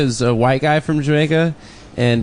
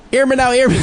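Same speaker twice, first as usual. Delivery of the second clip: much higher, louder Jamaican patois voice; 11 semitones higher, 3 dB louder, faster than usual. Retold or imitated. Roommate taking a family call, Jamaican patois. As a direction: bark into the phone like a Jamaican dad. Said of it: "speak a patois".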